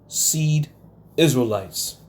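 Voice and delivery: ordinary delivery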